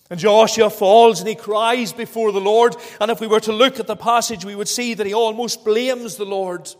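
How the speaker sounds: loud, ringing sermon voice; preaching. Acted. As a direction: drive the point home from the pulpit. Preaching at full force.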